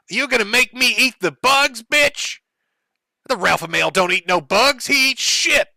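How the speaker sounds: high, loud mock Ralph rant. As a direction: ham up an angry Ralph taunting. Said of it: "decides he's gotta teach her a lesson".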